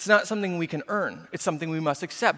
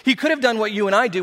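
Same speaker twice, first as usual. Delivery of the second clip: ordinary talk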